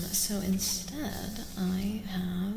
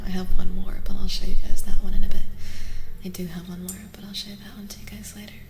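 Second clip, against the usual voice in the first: breathy intimate stream whisper; ASMR whisper; 13 dB louder than usual. Real, not acted. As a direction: whisper gently to the viewers as if right beside them.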